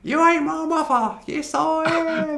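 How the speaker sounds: high, drawn-out soap melodrama; hammed-up soap showdown, wailed high; soap opera drama. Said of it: "famous lines from soap".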